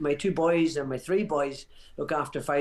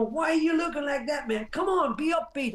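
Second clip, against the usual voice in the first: Muhammad Ali rallying shout; 10 semitones higher than usual. Retold or imitated. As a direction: shout it like a fighter pumping up his scared corner. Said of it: "he turns around and we go".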